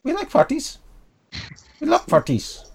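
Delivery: squeaky kobold, high then dropping; little creature savoring the idea; small creature voice. Acted. Kobold agrees about the party, small creature voice. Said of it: "trying to do a small creature accent".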